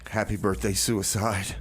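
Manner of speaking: normal talking voice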